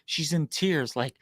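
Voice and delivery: ordinary talk, nothing marked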